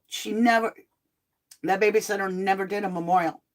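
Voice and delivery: normal talking voice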